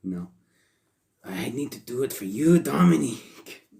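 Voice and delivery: a tender father's vow, hammed up in a Mexican accent; Rey Mysterio impression. Host repeats his mock Mexican accent, slowly stretching the name. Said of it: "it doesn't sound anything like him".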